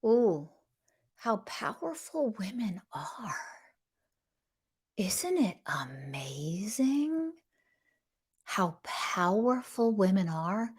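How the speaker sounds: slow, soft mock wonder; mock-reverent, near-whispered amazement; sarcastic awe. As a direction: marvel in hushed, drawn-out sarcasm. Sarcastic awe at women's supposed power.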